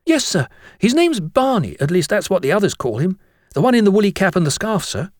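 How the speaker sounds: put-on high-pitched boy explaining himself; eager schoolboy. Jennings explains himself to the master, high eager voice. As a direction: gush out your story to the teacher.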